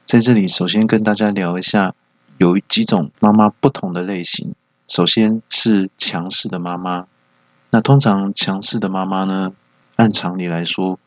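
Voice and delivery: normal talking voice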